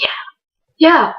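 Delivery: imitating a worn-out, lost voice; mock voiceless croak; voice gone. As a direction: squeeze it out as if voiceless. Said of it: "now his voice was gone".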